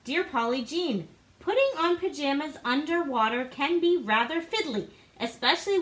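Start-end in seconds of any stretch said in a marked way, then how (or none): none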